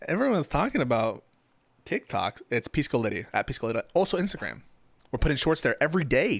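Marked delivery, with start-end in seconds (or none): none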